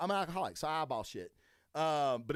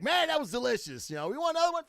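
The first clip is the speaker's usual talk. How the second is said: mock enthusiasm, much higher; pretending to love a drink to the host's face, hammed-up high; fake polite praise; 14 semitones higher, 6 dB louder, faster than usual. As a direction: gush over the cognac you secretly hate, pitched way up. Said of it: "been like".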